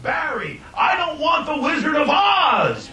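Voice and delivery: shouting high and loud, stretched out; desperate shout at Barry on the phone, high and slow; retold scream. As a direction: yell it down the phone at the studio boss. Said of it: "I remember screaming at him".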